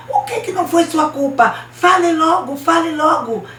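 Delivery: high, loud interrogation; impatient demand. Acted. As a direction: snap back, pushing for an answer. Girl demanding that he confess. (cobrança impaciente, aguda e alta)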